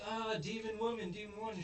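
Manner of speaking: put-on high-pitched panicked kids; hammed-up high cry of alarm; mock freak-out. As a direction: squeal it like frightened kids. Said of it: "they start freaking out".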